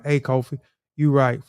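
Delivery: ordinary talk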